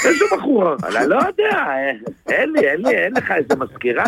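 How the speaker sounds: ordinary talk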